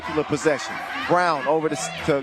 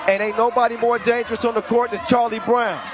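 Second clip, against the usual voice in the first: excited play-by-play; 7 semitones higher than usual. Acted. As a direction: call the action like the crowd's on its feet. Live play-by-play, excited call.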